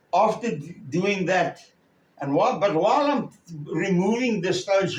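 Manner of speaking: ordinary talk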